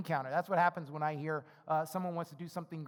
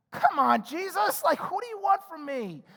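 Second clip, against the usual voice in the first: higher and louder, a fed-up shout; exasperated outburst; 9 semitones higher, 7 dB louder than usual. Retold or imitated. Disciples shouting at Jesus in exasperation. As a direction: throw up your hands at him.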